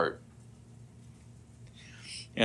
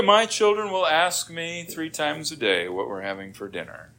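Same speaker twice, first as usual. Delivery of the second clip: ordinary delivery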